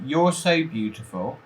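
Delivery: ordinary talk, nothing marked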